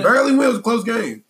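excited. Real, not acted. Hyped agreement about close game.

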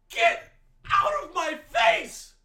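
angry shout. Real, not acted. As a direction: drag the repeat out, still yelling. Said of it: "I'm so sorry for getting that angry".

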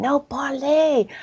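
high, drawn-out "parlez"; insistent, encouraging push on "parlez"; emphatic urging. Real, not acted. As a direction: urge them on with a slow, high "parlez". Urging hesitant listeners to speak.

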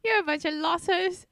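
high-pitched, badly faked New Zealand accent; mock New Zealand accent. Co-host mocking the misspelled insult, fake New Zealand accent. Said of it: "I don't know what accent that was".

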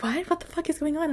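lightly mocked alarmed mom; quick high-pitched confusion, quoting mom; mom's startled reply. Mom's reply to freaking-out daughter, retold.

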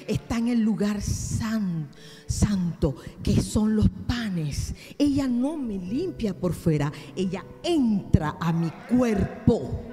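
loud, driving sermon voice; full-force pulpit delivery; fiery preaching. Acted. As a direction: preach it hard to the congregation.

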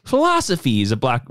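ordinary talk

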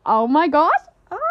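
high, loud "oh my God"; giddy, thrilled gasp; excited squeal. Shown as a demonstration. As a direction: squeal it like big news. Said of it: "Be excited talking to them about it".

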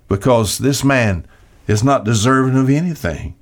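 usual speaking voice

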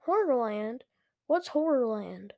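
ordinary delivery, nothing marked

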